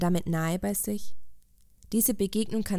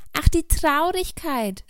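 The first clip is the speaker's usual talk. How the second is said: high, louder, drawn-out happy recognition; pleased storybook exclamation, pitched well up; delighted exclamation; 8 semitones higher, 5 dB louder, slower than usual. A narrator's reading. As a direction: cry out slowly as if greeting an old acquaintance. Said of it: "rief sie erfreut aus".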